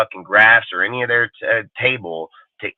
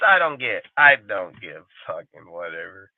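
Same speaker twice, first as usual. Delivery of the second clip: normal talking voice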